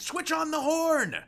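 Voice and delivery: high, loud desperate yell of a name; cry out. Acted. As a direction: scream his name over the roaring sea. Monster rushing the tower.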